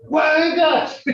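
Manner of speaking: "Juegas" as a firm order to quit; stern command. Shown as a demonstration. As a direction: bark "Juegas" like a warning. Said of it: "See how tone can say".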